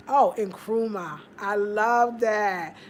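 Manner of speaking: ordinary delivery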